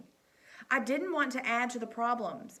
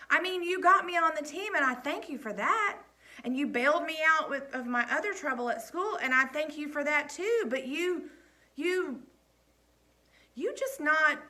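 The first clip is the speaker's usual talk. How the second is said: shaky confrontation; 6 semitones higher, 5 dB louder than usual. A narrator's reading. Boy shaking, confronting coach.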